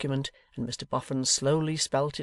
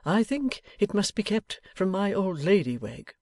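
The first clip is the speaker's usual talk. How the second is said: tame; 6 semitones higher than usual. A narrator's reading. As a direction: insist very meekly. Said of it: "and said tamely enough".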